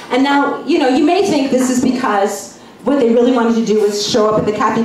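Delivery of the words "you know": normal talking voice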